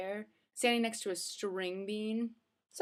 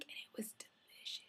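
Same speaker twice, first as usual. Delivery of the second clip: whispering; 15 dB quieter than usual. Real, not acted. Whispered aside.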